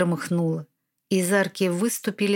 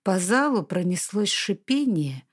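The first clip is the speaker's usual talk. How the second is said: ordinary delivery, nothing marked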